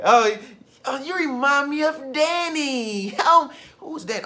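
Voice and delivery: loud, high, slow gasp at seeing the crush; excited gasp. Retold or imitated. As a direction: gasp out loud and slow like you just spotted him. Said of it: "some of y'all wanna manifest a new man".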